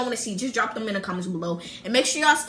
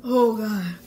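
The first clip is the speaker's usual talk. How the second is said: pained groan. Real, not acted. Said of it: "It's kicking in".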